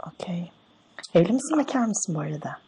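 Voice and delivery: soft, quiet put-on woman's voice; man playing a woman softly on the phone; man doing a woman's voice. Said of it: "Oğlum ben kadın sesi yapamam ki".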